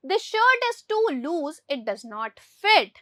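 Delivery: very slow, word by word, for the class; slow dictation. Shown as a demonstration. Teacher reads the English example to pupils very slowly. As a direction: spell out every word for Hindi-speaking pupils. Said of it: "teacher reads example sentence slowly".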